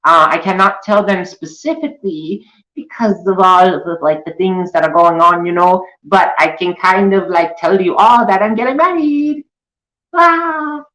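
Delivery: high giddy put-on voice; mocking Ace. Mocking Ace announcing her marriage on YouTube, high and giddy. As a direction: gush it in a high girly voice. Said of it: "she'd be like".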